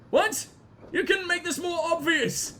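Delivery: high-pitched, exasperated; mock disbelief. Real, not acted. Player heckling the obvious Reaper reveal, high incredulous voice. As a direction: squeal it, utterly unconvinced.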